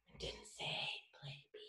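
very soft, near-whispered reveal; hushed aside. Real, not acted. Teacher catching out pupils in Simon Says, hushed. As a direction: whisper it as a sly reminder.

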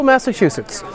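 ordinary delivery

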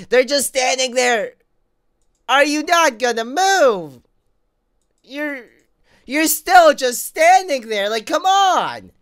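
high, loud, slow exasperated yell; frustrated yelling. Real, not acted. Yelling at animatronics that will not move, loud and exasperated. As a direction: drag it out, fed up with them.

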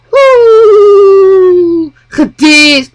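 high, loud mocking oohs; jeering cheer. Real, not acted. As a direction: crow over a burn. Cheering after dissing in game.

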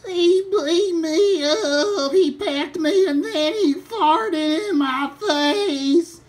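slow, hammed-up crying; mock blubbering. Farmer whining to the chef after the turkey beat him, fake sobbing. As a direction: whimper it out like a beaten farmer. Beaten farmer complains tearfully.

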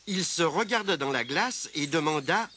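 plain reading voice

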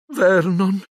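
frightened wife's call; trembling voice. A narrator's reading. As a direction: call him in fear. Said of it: "con voce tremante".

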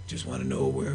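very low, softened impression; rugged voice. Sergeant Slaughter asking where to be, low rugged voice. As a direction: drop way down into a rugged veteran's voice. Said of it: "in that rugged voice he has".

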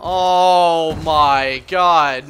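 long loud oh my god; loud disbelief. Real, not acted. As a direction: drag it out in exasperation. Reacting to game.

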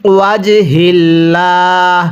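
ওয়াজহিল্লাহ recited slowly with a small push on জ; light push. Shown as a demonstration. Teacher showing students the stressed জ, slow recitation. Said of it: "একটু ধাক্কা দিয়ে পড়ব".

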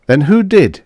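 high, loud challenging question; demanding. A narrator's reading. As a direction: demand an answer forcefully. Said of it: "he demanded".

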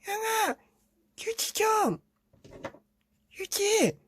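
put-on very high kid's voice; little brother begging his brother for tissue; little brother calling. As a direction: call out like a small child stuck on the toilet. Younger brother calls from toilet.

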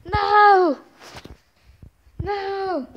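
loud high cry of dismay; anguished shout. Acted. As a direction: scream it as your man falls. Comrade falls in play battle.

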